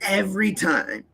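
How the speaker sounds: usual speaking voice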